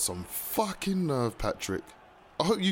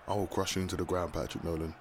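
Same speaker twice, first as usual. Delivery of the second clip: quiet menace; 5 semitones lower, 5 dB quieter than usual. Acted. Villain quiet threat.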